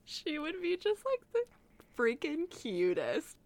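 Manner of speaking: squealing high with cute overload; gush, squeaky and loud, overwhelmed; overwhelmed by cuteness. Real, not acted. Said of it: "I'm getting, like, cute aggression".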